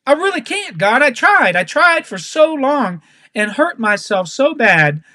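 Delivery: earnest, strained-with-effort appeal that gradually settles; wholehearted plea. Acted. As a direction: cry out to God for help. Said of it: "coming to God with my whole entire heart and saying".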